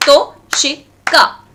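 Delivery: TO, SHI, KA in three separated beats; broken into syllables. Shown as a demonstration. Teacher claps to find syllables, To - shi - ka broken apart. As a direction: clap out To, shi, ka with a pause after each. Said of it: "clap and find the syllables".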